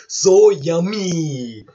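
slow, drawn-out greedy relish; put-on gluttonous delight; Big Mom. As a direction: drool over the food, stretching each word. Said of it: "And Big Mom is like".

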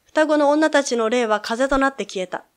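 ordinary talk

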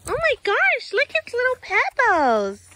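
high, gushing squeal of delight; real high-pitched gushing; delighted cooing. Real, not acted. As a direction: coo it high over something adorable. Cooing over little Pebbles.

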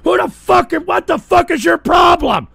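high, loud enraged shouting; real rage; furious yelling. Real, not acted. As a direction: scream at the troll. Streamer raging over doxing.

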